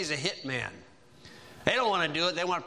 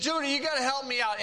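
higher, fast pleading; desperate plea; 8 semitones higher, faster than usual. Retold or imitated. Joseph in the pit begging his brother Judah, fast higher plea. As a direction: rush the plea, scared and begging.

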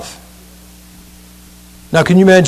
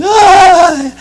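high squeal; a sudden high giggly shriek; hammed-up shriek; girly shriek; 13 semitones higher than usual. Mock girlish shriek.

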